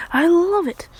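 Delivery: high, loud burst of delight; delighted gush. Retold or imitated. Woman just fired her cannon, loud high delight.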